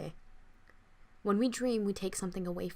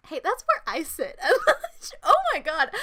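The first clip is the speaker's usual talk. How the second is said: laughing; 11 semitones higher, 12 dB louder than usual. Real, not acted. Reacts laughing to the text.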